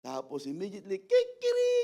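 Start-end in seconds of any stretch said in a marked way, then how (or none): 1.0-1.8 rooster crow. Acted. Preacher imitates the rooster after Peter's denial, high crow. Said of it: "Sabi ng manok".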